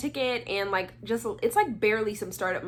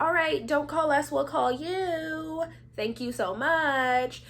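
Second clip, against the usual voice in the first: high, drawn-out singsong send-off; polite brush-off; 6 semitones higher, slower than usual. Retold or imitated. Judges send auditioner off after photo, mock singsong politeness. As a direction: imitate a sweetly stretched, slow goodbye. Judges dismissing her with stock goodbye.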